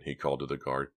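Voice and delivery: ordinary delivery, nothing marked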